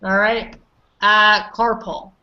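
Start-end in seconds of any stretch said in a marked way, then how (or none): 1.5-2.1 "carpool" said as carpal, slowly, falling; model CARPAL. Shown as a demonstration. Teacher models it before Dimitri pronounces it, correct carpal. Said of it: "do you mind pronouncing it for me".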